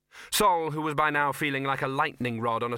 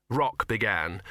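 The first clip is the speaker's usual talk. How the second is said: usual speaking voice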